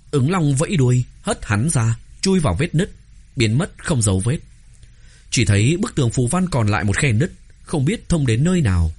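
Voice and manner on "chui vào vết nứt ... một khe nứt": ordinary delivery, nothing marked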